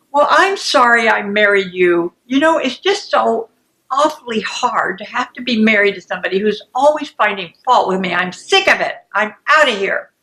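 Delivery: hurt and angry, pressing every complaint; escalating anger. Acted. Second person threatens to leave the marriage, angry. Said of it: "And it escalates".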